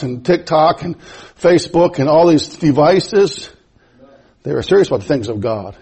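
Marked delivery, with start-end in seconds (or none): none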